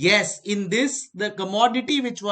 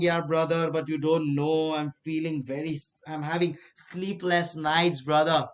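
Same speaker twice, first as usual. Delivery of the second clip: ordinary delivery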